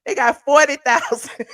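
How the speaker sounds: "forty thousand" laughed out in disbelief; laughing correction. Real, not acted. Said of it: "Not four thousand".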